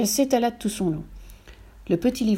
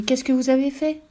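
high, curious question climbing at the end; intrigued girl's lifted voice; 6 semitones higher than usual. A narrator's reading. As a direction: ask as if burning to know, pitched up. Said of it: "dit Brumeline très intriguée".

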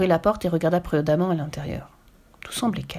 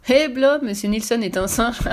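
higher, louder call breaking into a chuckle; can't help laughing; 4 semitones higher, 4 dB louder than usual. A narrator's reading. Burglar telling his partner about the monkey, laughing. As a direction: call out amused, then laugh at the end. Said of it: "ne put s'empêcher de rire".

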